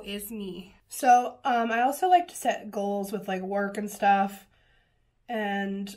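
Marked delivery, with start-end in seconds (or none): none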